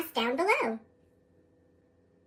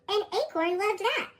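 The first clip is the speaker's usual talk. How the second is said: usual speaking voice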